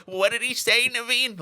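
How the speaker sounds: high-pitched, hard-of-hearing elder; exaggerated high, confused old-timer voice; mock old person. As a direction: play someone old who missed what was said.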